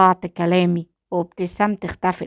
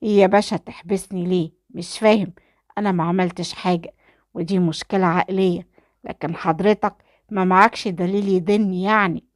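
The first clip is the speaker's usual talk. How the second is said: ordinary delivery, nothing marked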